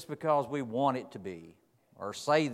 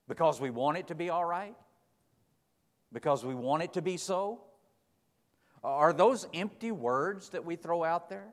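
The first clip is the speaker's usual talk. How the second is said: higher and louder, pressing the congregation; rising preaching; 7 semitones higher, 4 dB louder than usual. Acted. Preacher challenging the church about empty promises, rising and louder. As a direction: build the sermon, hammering each question.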